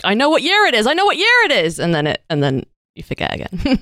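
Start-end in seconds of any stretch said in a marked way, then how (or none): 0.0-1.8 high, eager exclamation; excited recognition. Retold or imitated. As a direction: blurt it out proudly. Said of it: "And then you're like".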